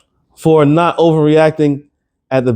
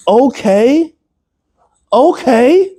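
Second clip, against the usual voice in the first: sarcastic okay; 9 semitones higher, much slower than usual. Real, not acted. Sarcastic concession before rebuttal.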